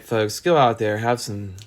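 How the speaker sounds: ordinary delivery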